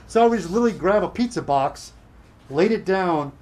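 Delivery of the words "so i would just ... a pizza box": ordinary talk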